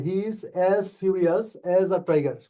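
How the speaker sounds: slow, careful reading with each as marked; slow corrected sentence. Shown as a demonstration. Teacher dictating the corrected sentence slowly to students. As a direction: spell out the corrected line slowly. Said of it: "এই জায়গাটা correction করে নেবেন".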